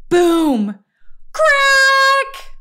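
loud crash effects. A narrator's reading. Narrator voicing the floor breaking, loud high crashes. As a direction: shout both crash words, climbing on the crack. Crash in a read-aloud story.

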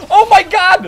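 loud, high outburst; exasperated yell. Real, not acted. Gamer reacting to guns that fail him, exasperated yell. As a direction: yell it out in disbelief. Yelling at slow guns in match.

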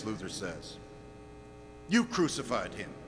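low, subdued verdict; grave hushed accusation. Real, not acted. Preacher telling listeners they crucified Christ, grave and hushed. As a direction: lay the charge down quietly.

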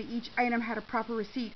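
ordinary delivery

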